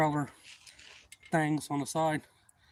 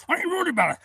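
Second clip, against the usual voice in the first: high-pitched, louder mocking imitation; mimicked stubborn man; 5 dB louder, much faster than usual. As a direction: mimic his dismissal, pitched way up. Mimics stubborn man dismissing danger.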